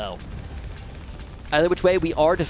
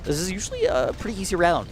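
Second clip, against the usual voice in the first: ordinary delivery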